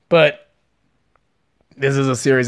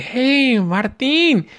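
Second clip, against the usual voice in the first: high, sing-song come-on, imitating a creepy coworker; 11 semitones higher, much slower than usual. Coworker cornering him in the theater, high creepy come-on. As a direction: lure him in with a sly high greeting. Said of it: "He's like".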